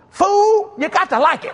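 wife scolding her husband over the dress, laughing; mock wife scolding. Wife scolding him in quarrel.

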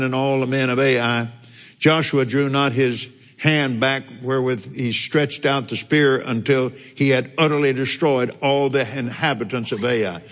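ordinary delivery

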